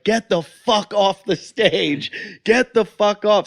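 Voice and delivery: slow, high, drawn-out heckle; acted angry order. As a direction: order him off, stretching the words. Acts out club ordering comic offstage.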